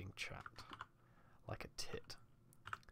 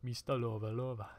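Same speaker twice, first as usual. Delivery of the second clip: mock greeting; 8 dB louder than usual. Mock greeting to Mister Lover.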